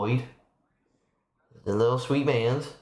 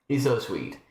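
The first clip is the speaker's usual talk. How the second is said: ordinary talk, nothing marked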